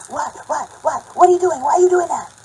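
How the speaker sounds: loud, high mock scream; rapid-fire barked questions, mimicking a screamer; imitated screaming. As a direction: act out a man screaming at you. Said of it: "He's screaming at me".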